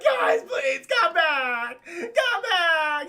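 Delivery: high, loud sobbing plea; crying kid plea. Retold or imitated. Abandoned man wails after the group like a child. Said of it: "like the kid from the Christmas Story".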